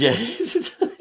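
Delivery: high laugh through the words. Real, not acted. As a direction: laugh at the joke. Laughing at the pun.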